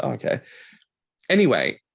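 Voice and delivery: ordinary talk, nothing marked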